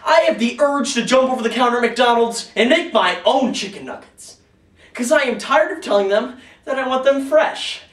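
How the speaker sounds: loud, high-pitched indignant complaint; exasperated rant. Acted. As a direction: rant like a fed-up customer, loudly. Acted rant about stale nuggets.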